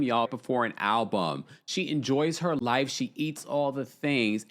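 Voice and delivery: ordinary talk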